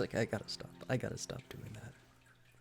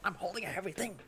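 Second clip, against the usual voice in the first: higher strained voice, a hint of panic; mock strained plea; 9 semitones higher, faster than usual. Retelling being hit by the fan, mock strained plea. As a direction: pitch up, pleading while straining. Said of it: "ceiling fan goes like".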